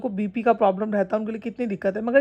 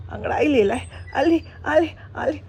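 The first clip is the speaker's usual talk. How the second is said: put-on baby talk; 3 semitones higher than usual. Cooing to the stretching dog.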